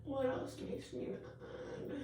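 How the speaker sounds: slow, breathy half-whisper to herself; whispered musing, slowed right down; hushed and slow. Real, not acted. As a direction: murmur the question slowly under your breath. Repeats the question, hushed.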